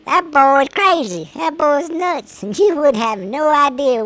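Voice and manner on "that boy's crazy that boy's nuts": slow, louder mock verdict; slow, louder imitated judging listener. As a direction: draw it out like a head-shaking listener. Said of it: "you'd be like".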